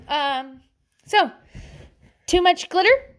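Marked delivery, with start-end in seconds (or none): none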